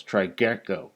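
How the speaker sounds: usual speaking voice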